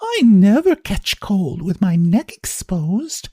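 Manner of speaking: a vain, teasing neighbour showing off; lively society lady. Acted. Mrs Wilson character voice.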